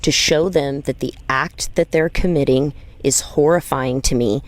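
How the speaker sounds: normal talking voice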